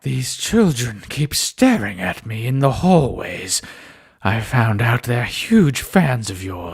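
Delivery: low, slow villainous voice; evil sister. Retold or imitated. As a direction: draw it out low like a sinister sister. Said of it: "evil sister impression voice".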